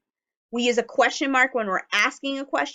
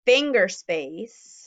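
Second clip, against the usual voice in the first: slow modelling; much slower than usual. Shown as a demonstration. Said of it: "slow modelling".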